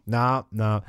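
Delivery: normal talking voice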